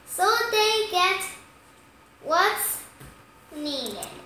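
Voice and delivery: ordinary delivery, nothing marked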